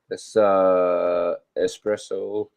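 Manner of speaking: ordinary delivery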